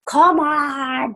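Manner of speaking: high, pushy come on; mock impatient urging. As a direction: hurry them along, voice up high. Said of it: "we're all like".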